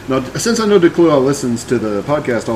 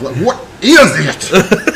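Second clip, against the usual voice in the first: slow drawling heat-struck voice; sweaty Southern gentleman; 4 semitones higher, 3 dB louder, much slower than usual. Pretend. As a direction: play a fat sweaty Southern gent. Sweaty Southern gent impression.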